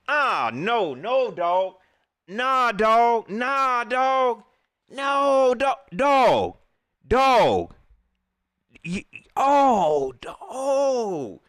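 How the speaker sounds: loud, drawn-out cries of disbelief; upset. Real, not acted. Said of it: "I'm getting upset now".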